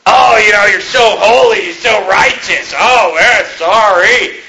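hammed-up sarcastic taunt; mocking accuser. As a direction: sneer it like a scornful critic. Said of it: "you know what would have happened".

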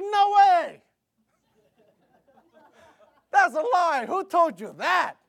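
loud, high shocked protest; outraged disbelief. Retold or imitated. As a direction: cry out in indignant disbelief. Acted shocked reply to brother.

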